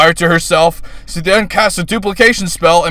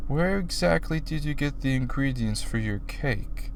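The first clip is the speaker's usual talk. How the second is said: slow, labored; 5 semitones lower, 15 dB quieter than usual. Acted. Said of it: "her voice working slowly through the words".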